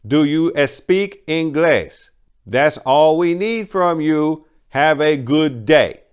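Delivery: high, loud, slow condescending clerk; rude clerk. Acted. Clerk talking down to Maria, loud and slow. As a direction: talk down to her, drawn out.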